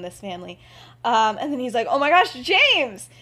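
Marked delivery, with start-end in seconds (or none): 1.9-3.1 high, loud, drawn-out cry of surprise; startled exclamation. Acted. Dad finding James in the fireplace, startled. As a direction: exclaim it, stretching out the name.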